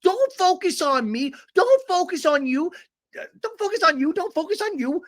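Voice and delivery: very high mock scream, faintly faux British; high put-on voice, insistent and repetitive; [name]. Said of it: "[name] screams".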